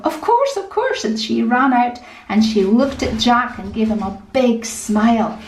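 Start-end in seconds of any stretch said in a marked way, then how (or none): 0.0-1.0 high, bright-voiced girl answering at once; a young daughter's high, willing reply; eager young girl. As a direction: chirp it back like a keen daughter. Julia eagerly answers her father.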